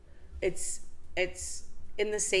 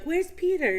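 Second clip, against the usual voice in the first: mock question; 11 semitones higher, 4 dB louder than usual. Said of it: "they're like".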